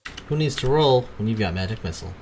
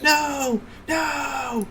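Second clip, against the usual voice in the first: high, drawn-out cry of refusal; acted screaming. Trapped son denies being a monster, high screaming. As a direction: scream it high and strained, without full volume. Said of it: "he just starts screaming".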